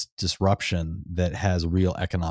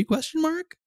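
squeaky rising question. Real, not acted. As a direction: voice jumps up into a squeak on "mark". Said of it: "a nice little squeak at the end there".

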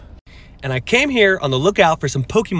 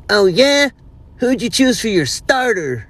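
put-on cocky kid; 8 semitones higher, 5 dB louder than usual. Kid quizzing him again.

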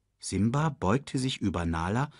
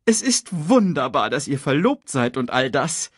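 louder, higher character voice; lightly voiced Sasu, congratulating; 6 semitones higher, 4 dB louder than usual. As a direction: congratulate the young couple in a lifted voice.

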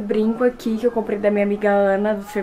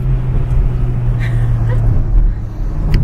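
high laugh; 14 semitones higher, 8 dB louder than usual. Real, not acted. Laughing with child.

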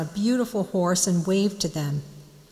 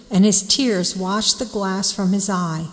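usual speaking voice

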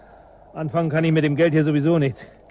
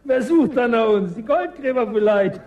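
mocking; 5 semitones higher than usual. Mocking echo of her fear.

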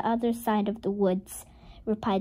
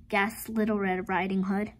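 ordinary delivery

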